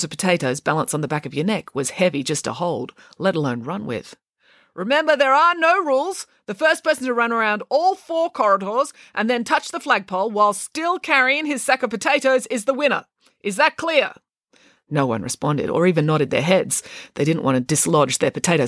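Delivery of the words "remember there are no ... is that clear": high, projected voice of an official; marshal's announcement. Acted. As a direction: lay down the rules to a crowd. Vice principal briefs the runners.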